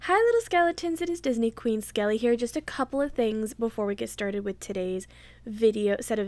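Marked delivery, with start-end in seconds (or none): none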